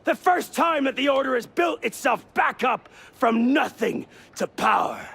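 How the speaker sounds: high-pitched shouted tirade; angry tirade. Acted. Veteran assassin justifies purging the leadership, angry tirade. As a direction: rail at him, question upon question. Accused man rants back.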